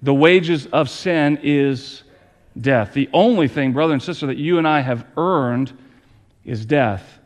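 low, grave preacher's voice; solemn preaching. Acted. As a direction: let "death" drop heavy. Solemn sermon point on death.

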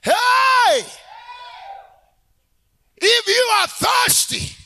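full-force, high-pitched sustained shout; shouting Jesus. Acted. Preacher showing how Jesus cried out, huge high shout. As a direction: holler it out to a whole crowd. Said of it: "Jesus was a shouting person".

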